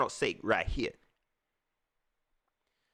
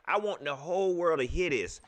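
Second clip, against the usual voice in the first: ordinary delivery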